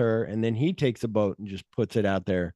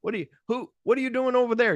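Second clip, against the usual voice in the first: high, rising cranky boss; Dick Miller; 11 semitones higher, faster than usual. Retold or imitated. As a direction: imitate a grumpy boss yelling at workers. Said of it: "now you see Dick Miller".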